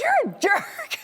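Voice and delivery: very high playful echo; mock echo. [name] echoing her jerk back, high and playful. Repeats his answer, laughing.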